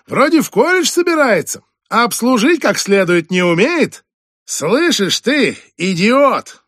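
mock him to the guests, then yell; furious rant. Boss rants to guests.

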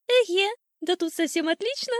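high, loud, pleased bird voice; delighted old sparrow. A narrator's reading. Sparrow inspecting the new birdhouse alone, high delighted chirp. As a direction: exclaim up high to yourself. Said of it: "думал вслух старый воробей".

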